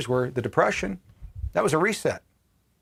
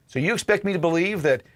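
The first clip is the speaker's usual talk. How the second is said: ordinary talk, nothing marked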